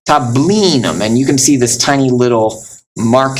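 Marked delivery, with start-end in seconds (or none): 0.1-1.0 "tablinum" with the weight on "bli"; second-to-last syllable stressed. Shown as a demonstration. Teacher giving another example, stress on middle syllable. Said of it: "on the second to last".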